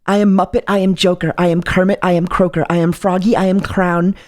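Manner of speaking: low, solemn slam-poetry reading of each line; dramatic poem recital. Pretend. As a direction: read it like a grave spoken-word piece. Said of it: "Bars. Actual bars".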